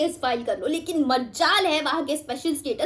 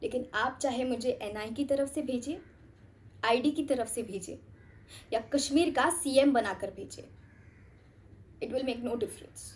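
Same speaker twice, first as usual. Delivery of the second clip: low, soft, measured delivery; quiet blunt candour; 3 semitones lower, 9 dB quieter than usual. Acted. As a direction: speak frankly but keep it hushed and controlled. Blunt warning to a superior. (धीमी, संयत, बेबाक आवाज़)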